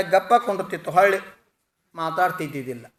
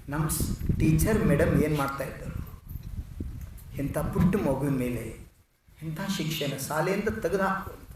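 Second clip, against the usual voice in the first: hushed, pained words; moved to tears; 2 semitones lower, 9 dB quieter than usual. A narrator's reading. Principal, moved by a small child's story, quietly tearful. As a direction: speak softly, understated grief. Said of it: "ಕಣ್ಣಾಗ ನೀರು ಬಂದು".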